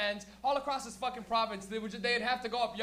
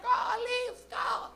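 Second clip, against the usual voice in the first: high-pitched put-on granny cheer; cheering grandmother; 14 semitones higher, 4 dB louder, much slower than usual. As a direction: whoop like a little old fan. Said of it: "little grandmothers and shit would come out".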